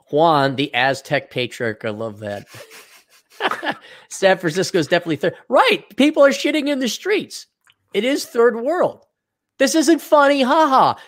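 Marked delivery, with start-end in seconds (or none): 5.5-11.0 high-pitched, insistent outrage; indignant rant. Real, not acted. Streamer rants to co-host about San Francisco, indignant. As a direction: drive each point home, voice pitched right up.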